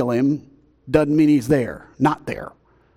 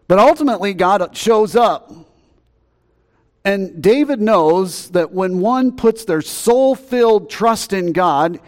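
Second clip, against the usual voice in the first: rising, louder preacher's cry, slowed right down; full-force preaching; 7 semitones higher, 5 dB louder, slower than usual. Acted. Pastor stirring the congregation, preaching loud and slow. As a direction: preach it out, drawing each word long.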